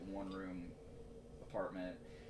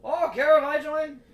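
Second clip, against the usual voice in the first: high, loud mocking voice; mocking friend voice; 12 dB louder, slower than usual. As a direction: squeak it out like the clueless friend. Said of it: "he's like".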